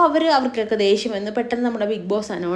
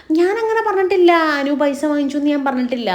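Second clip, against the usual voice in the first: heated denial; 5 semitones higher than usual. Retold or imitated.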